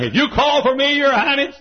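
high, loud prophet hailing a king; mock grand announcement. Micaiah walks up before the king, mock grand high announcement.

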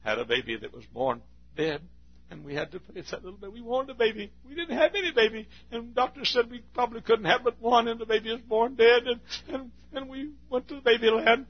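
high woman's voice telling her loss; grieving mother. Retold or imitated. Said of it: "the other person says".

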